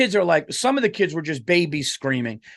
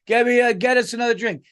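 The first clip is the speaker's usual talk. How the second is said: mock yell across the table, higher, louder and fast; imitating a yell; 8 semitones higher, 4 dB louder, faster than usual. Parent at the rowdy birthday table yelling for drinks, mock yell. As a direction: bark a drink order over the noise in mock. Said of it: "yelling across the table".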